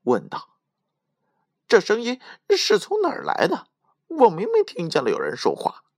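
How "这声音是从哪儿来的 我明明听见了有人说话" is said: high, alarmed questioning voice; spooked thief, pitch jumping up; startled. A narrator's reading. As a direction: ask in great astonishment. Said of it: "大吃一惊，问道".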